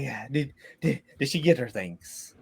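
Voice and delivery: low, soft, hesitant questioning; subdued quest-giver. Acted. Quest-giver asking if Grandma Jenny got her things, subdued. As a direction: ask it meekly, stumbling at the start.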